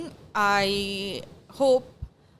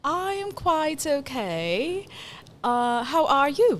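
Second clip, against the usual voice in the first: high, slow put-on British accent; 4 semitones higher, much slower than usual. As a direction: answer in deliberate, careful British. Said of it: "I'll try British".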